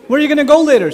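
high, loud acted question; prying father. Father questions his silent teenage son, eagerly prying. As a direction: play a dad pushing for conversation.

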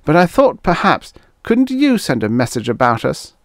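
high-pitched, pleading request; tentative appeal. A narrator's reading. Trent asks Guthrie to send word, tentative appeal. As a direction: plead hopefully, voice pitched well up. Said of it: "he tried to pick up where he had left off".

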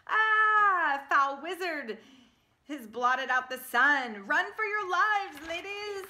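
very high, loud panicked cries; loud high screeching alarm; panicked creatures. Acted. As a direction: shriek a warning to flee. Trail mix creatures panic.

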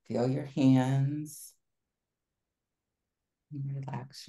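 guided relaxation. Acted. Leads a relaxation exercise.

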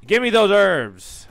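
slow, loud, high greedy demand. Pretend. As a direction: grab those herbs greedily. Greedy grab at item.

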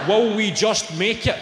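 pleading suggestion. Retold or imitated. Quotes players bargaining over rules.

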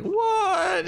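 high, slow drawn-out "what"; baffled squeak. Retold or imitated. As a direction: stretch it out in disbelief. Imitating baffled reaction.

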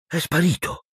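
high-pitched astonishment; astonished. A narrator's reading. Boy finds the card's face gone, astonished. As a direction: say it high, in amazement. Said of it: "con suo grande stupore".

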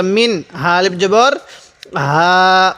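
ordinary talk

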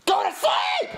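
very high, cracking-point yell; furious scream. Acted. Dad at wit's end, furious scream. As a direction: scream the order at the top of the range.

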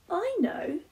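bright, high eureka voice; pleased with a sudden idea; Rufus's idea voice. A narrator's reading. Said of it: "he said".

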